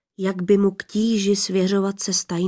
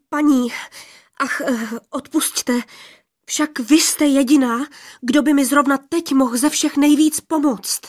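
higher, louder, urgent pleading; a frightened youth begging for help; anxious plea; 6 semitones higher, 4 dB louder than usual. A narrator's reading. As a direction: plead with her, stumbling on the first words. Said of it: "A celý ze strachu, jestli ho vyslyší".

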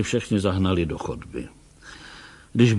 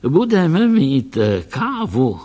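slow, higher and louder, full of glee; delighted; 4 semitones higher, 5 dB louder, slower than usual. A narrator's reading. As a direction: savour the promise of coffee like a treat. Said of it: "radoval se ten malý pán".

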